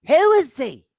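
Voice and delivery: put-on high, loud suspicious old woman; Agnes O'Leary voice. Agnes grilling the caller about her son, high and loud. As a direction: demand it up high and sharp. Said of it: "Agnes is getting nasty".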